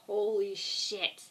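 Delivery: slow, hushed stunned reaction; awestruck. Retold or imitated. Recalling first watch of the pilot, slow stunned awe. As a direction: let it sink in slowly, stunned.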